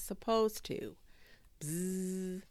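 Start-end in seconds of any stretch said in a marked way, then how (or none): 1.6-2.4 droning insect buzz, held; bumblebee buzz. A narrator's reading. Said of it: "just a very big bumblebee".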